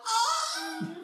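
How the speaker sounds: high-pitched mock moan of "oh"; hammed-up falsetto wail; imitated climax cry. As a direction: imitate a man's squealing high note. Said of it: "That's exactly how it sounded".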